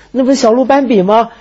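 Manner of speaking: put-on high, delighted child's cry; excited little child. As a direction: squeal it like a thrilled kid. Excited child spotting a fawn.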